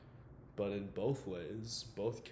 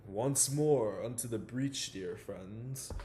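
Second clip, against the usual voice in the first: mock-grand recital, opening big and easing down; theatrical Shakespeare quote; 5 dB louder, slower than usual. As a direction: declaim it loud and slow, then drop off. Quoting Shakespeare inside acted monologue.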